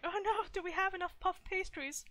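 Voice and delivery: high-pitched mock panic; acted fretting. Artist acting themself as anxious event organizer, high mock fretting. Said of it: "I'll be like".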